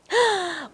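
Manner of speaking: sharp stagey intake of breath; mock surprised gasp. Teacher pretends to discover the letter, surprised gasp. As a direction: gasp as if you just spotted it.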